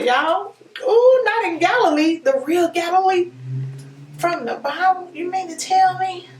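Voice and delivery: gushing over the real Galilee; getting excited. Real, not acted. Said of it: "Y'all, I'm getting excited".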